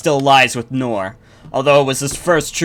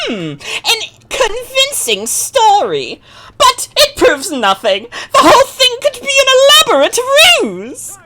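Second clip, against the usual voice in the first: sly adviser cackling accusations to the king; Joker-like schemer; 4 dB louder than usual. Acted. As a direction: play Iago as a giggling, unhinged court schemer. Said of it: "that voice for King Garon".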